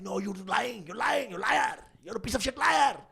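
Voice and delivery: high-pitched, held-back imitation of angry yelling; mock yelling. Uncle accusing nephew of lying, imitated yelling. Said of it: "he's, like, yelling".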